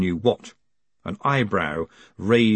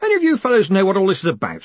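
raised, higher voice in a quick rush; pitched up to carry above the din; 8 semitones higher, 5 dB louder, much faster than usual. A narrator's reading. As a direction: call it out quickly over a noisy room. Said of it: "raising his voice above the din".

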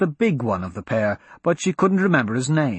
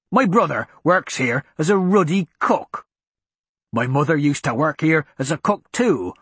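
angry; slower than usual. Acted. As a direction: growl it out with contempt. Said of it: "Mason's expression darkened".

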